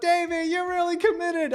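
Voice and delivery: put-on high, slow old man call; old neighbor Ernie calling from his porch, high and slow; old-timer yelling. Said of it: "he yells out".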